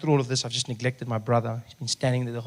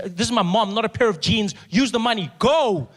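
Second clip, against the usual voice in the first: high, emphatic acted insistence; exasperated order; 8 semitones higher, 3 dB louder than usual. Son ordering nurse to spend for mom, high exasperation. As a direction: order the nurse off, losing patience.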